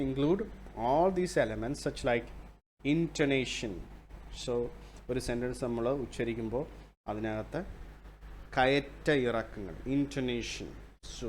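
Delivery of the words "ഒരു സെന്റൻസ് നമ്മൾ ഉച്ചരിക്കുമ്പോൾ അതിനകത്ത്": normal talking voice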